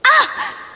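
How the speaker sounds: sharp high yelp of pain; a short hurt yelp as a blow lands; sudden high cry of pain. Acted.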